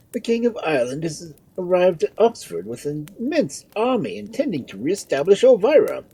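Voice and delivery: high-pitched, loud herald; urgent report to a lord; announcing news. A narrator's reading.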